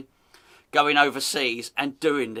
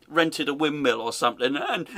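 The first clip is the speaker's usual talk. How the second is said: usual speaking voice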